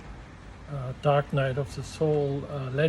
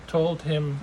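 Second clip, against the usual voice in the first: ordinary delivery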